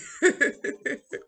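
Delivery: high helpless laugh at her own story; real burst of laughter. Real, not acted. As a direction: crack up laughing, out of breath. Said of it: "which was funny to me too".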